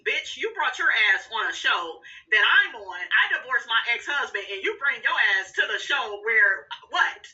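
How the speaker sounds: normal talking voice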